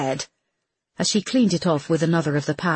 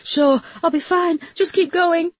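high, strained through gritted teeth; 13 semitones higher than usual. Acted. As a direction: force it out through clenched teeth. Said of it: "spoke through gritted teeth".

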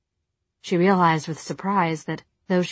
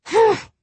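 high indignant scoff; scoffing humph; 6 dB louder than usual. A narrator's reading. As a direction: huff with contempt. Tessa scoffs at him.

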